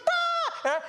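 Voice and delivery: very high imitated wail; mock child's scream. Dad imitates his son's reaction to a spanking, high wail. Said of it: "he's screaming, he's crying".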